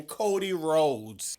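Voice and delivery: usual speaking voice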